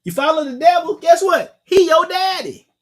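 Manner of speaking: rising preacher shout. Real, not acted. Preacher warning devil followers, high and loud. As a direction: build to a loud, high climax.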